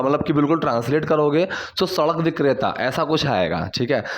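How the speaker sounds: ordinary talk, nothing marked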